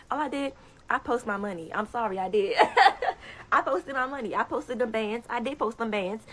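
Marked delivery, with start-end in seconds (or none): 2.6-3.5 gleeful high cackle; loud high laugh. Real, not acted. Laughing while bragging about posting her money.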